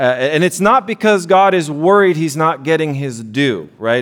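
normal talking voice

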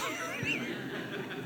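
short burst of real laughter from the preacher. Real, not acted. Preacher laughing at his own punchline, real laughter.

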